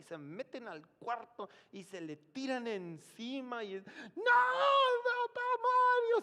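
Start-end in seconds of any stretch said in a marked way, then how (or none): 3.9-6.2 very high, loud crying shout; wailing cry. Retold or imitated. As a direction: scream in grief over Mario. Family wailing over the dead man. (grito llorando de dolor)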